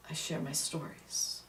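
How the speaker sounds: soft whisper of the answer. Real, not acted. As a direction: let it fall to a hush.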